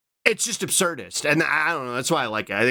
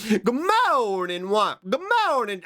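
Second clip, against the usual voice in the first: high cutesy greeting; 5 semitones higher than usual. Retold or imitated. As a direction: stretch the morning out, sweet and high. Quoting the greeting meme.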